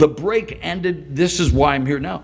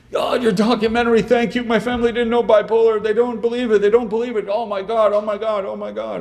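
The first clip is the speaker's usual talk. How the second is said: high, fast, overwrought mock sobbing thanks; hammed-up weepy fan, words tumbling out; imitating tearful gushing; 9 semitones higher, 4 dB louder than usual. Grateful fan in tears over the documentary, imitated gushing. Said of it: "guy grabs me in tears".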